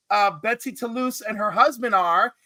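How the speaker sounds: usual speaking voice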